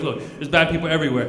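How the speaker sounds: trying to sound deep; mock-profound moral. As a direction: offer it like an Aesop moral. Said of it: "like, an Aesop moral of the story".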